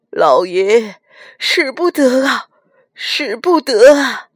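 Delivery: urgent servant's begging, fairly loud; desperate plea. A narrator's reading. Kneeling servant holds back his master, pleading. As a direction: implore the master to stop. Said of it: "家丁双膝跪地".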